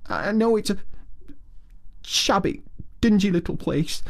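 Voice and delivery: high, broken sobbing voice; sobbing brokenly. A narrator's reading. Mole confessing his longing for home, sobbing brokenly. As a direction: force the words out between sobs. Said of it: "he sobbed forth at last, brokenly".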